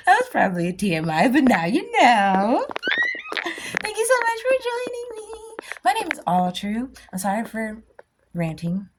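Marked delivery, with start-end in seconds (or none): none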